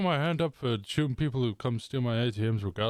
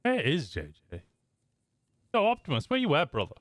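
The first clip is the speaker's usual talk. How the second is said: high, carrying call across the room; 6 semitones higher than usual. Real, not acted. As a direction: shout out to find a buddy. Calling out for a missing player.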